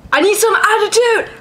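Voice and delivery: high-pitched, sassy creature voice; Krabby with attitude. Retold or imitated. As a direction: voice a demanding little creature. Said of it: "And it's like".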